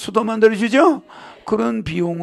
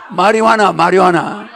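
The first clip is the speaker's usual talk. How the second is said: loud, laughing through the pun; gleeful pun, laughing; laughing punchline; 8 dB louder than usual. Real, not acted. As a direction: land the joke while cracking up. Delivering a marijuana pun.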